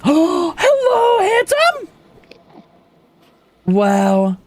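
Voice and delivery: very high squealed hello, then pleading come back; high excited calling. Pretend. Streamer calling after a mob that runs away, squealing high. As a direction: chase him with your voice.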